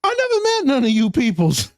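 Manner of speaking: high put-on voice of his dad; dad's voice. Son imitating his father in a high voice. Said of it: "Pops is like".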